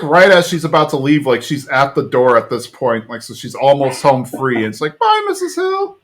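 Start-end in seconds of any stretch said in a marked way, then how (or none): 5.0-6.0 very high-pitched goodbye; Mike. Retold or imitated. Mike calling to Peggy at the door, high call. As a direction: imitate an innocent, attention-drawing farewell. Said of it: "Mike has to draw everyone's attention".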